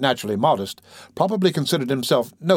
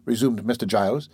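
plain reading voice

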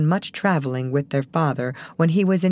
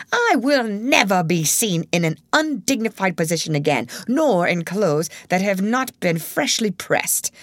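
angry; 4 semitones higher than usual. A narrator's reading. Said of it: "cried Mister Town angrily".

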